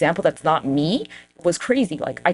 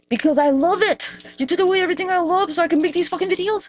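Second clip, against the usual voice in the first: high-pitched, whiny outrage; hammed-up aggrieved wail; indignant protest; 11 semitones higher than usual. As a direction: plead it as a wronged complaint, pitched way up. Comic protest at losing their art.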